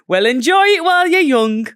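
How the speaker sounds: loud, high Geordie put-on; Newcastle accent. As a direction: call it out in a hammed-up Geordie accent. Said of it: "concentrating on my Newcastle accent".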